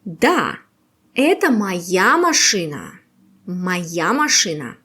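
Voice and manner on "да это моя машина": ordinary delivery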